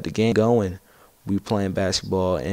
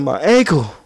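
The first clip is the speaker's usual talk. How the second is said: imitating a high, louder whine over a hurt ankle; mock whining; 6 semitones higher, 7 dB louder than usual. Injured friend complaining, in a high mock whine. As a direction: whimper it like a kid playing up the pain. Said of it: "he was, like, whining".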